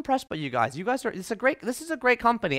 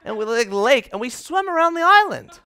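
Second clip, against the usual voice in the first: higher, louder enthusiastic rave; excited gushing; 5 semitones higher, 5 dB louder than usual. Retold or imitated. Date describing family swim to him, excited gush. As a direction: sell the family swim with glee. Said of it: "she's like".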